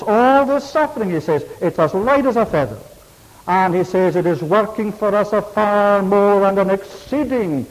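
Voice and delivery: high, loud, full-force preaching; rising preacher's fervour; fervent preaching. Acted. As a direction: drive it home from the pulpit.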